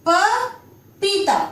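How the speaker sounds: पपीता said very slowly, each syllable drawn out; slow stretched reading. Shown as a demonstration. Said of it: "देखिए इसको कैसे बोल रहे हैं?". (पपीता को बहुत धीरे खींचकर बोलना)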